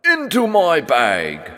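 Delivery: high, loud, slow gloating command; monster shout. A narrator's reading. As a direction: order it into the bag. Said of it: "shouted the monster".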